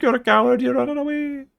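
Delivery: very high, squeaky mock Irish taunt; mock leprechaun. Leprechaun jeering at fleeing Kirk, mock Irish voice. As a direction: jeer at him like a cartoon leprechaun.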